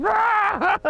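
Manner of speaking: very high mock shriek; hammed-up high-pitched cry; frantic cry. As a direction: shriek like a drunk lady losing it. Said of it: "And she's like".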